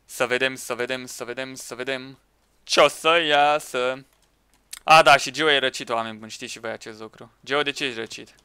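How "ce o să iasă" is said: normal talking voice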